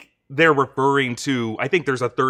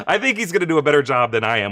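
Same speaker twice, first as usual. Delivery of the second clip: normal talking voice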